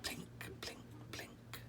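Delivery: soft whispered plinks like water drops; whispered drips. Acted. Narrator making the dripping tap sound, whispered. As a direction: drip it out in a whisper. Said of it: "The yard tap drips".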